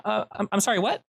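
put-on startled little boy asking again; ten-year-old kid. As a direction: caught off guard, apologetic kid. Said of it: "turns to you after finishing and says".

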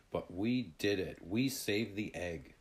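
usual speaking voice

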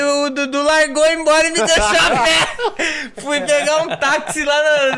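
laughing. Real, not acted. Conta rindo que foi abandonado.

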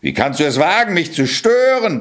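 a lightly voiced raging ogre, climbing high; a hint of ogre fury at being disturbed. The ogre raging at the intruding cat, mildly voiced. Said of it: "tobte der Oger".

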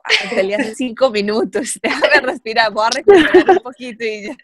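giggling through the words; laughing while talking. Retold or imitated. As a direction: laugh it out as you speak. Laughing while quoting a breathless fighter.

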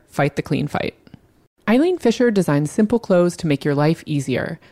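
smooth sponsor read. Acted. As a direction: read it like a podcast ad. Podcast ad read.

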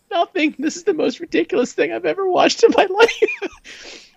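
high-pitched, laughing through the words; helpless laughter. Retold or imitated. Dad telling his kids why he laughs, high and cracking up. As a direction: squeal it out while cracking up.

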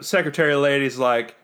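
normal talking voice

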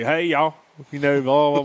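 mock a Southern "y'all" greeting; country accent. Shown as a demonstration. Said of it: "North Carolina accent or that little Southern accent".

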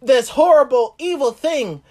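mock the king's fury; David's outrage. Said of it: "he basically said".

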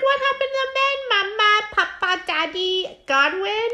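put-on high, crying child voice; mock whiny kid; kids asking. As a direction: squeal it like a scared little boy. Kids' voice continues.

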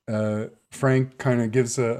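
ordinary talk

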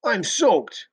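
loud, very high-pitched cry of protest; outraged yell. A narrator's reading. Drunk soaked by his companion, yelling in protest. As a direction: yell your disgust at being drenched. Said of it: "yelled the fast one".

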